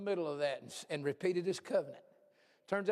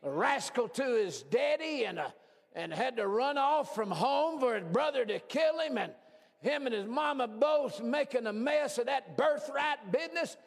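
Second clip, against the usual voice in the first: high, loud shouting preacher; preaching at full force; 6 semitones higher, 4 dB louder than usual. Acted. Preacher urging the church to wait on God's promise, shouting. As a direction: drive it home to the congregation.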